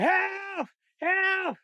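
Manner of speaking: high panicked yell; cry for help. Retold or imitated. Big sister scaring off the stranger, high yell. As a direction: call out for help in alarm.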